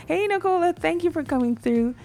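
ordinary talk

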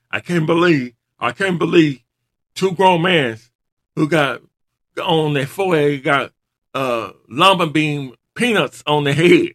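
hammed-up Rock trash talk; imitating the Rock. Imitating the Rock insulting New Day, loud mock rant. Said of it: "Rock said".

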